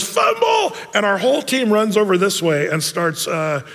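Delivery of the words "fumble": sudden high shout of Fumble!; yelling fumble. Retold or imitated. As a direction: yell Fumble! way up high, full voice, to fool the defense. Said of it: "he yells".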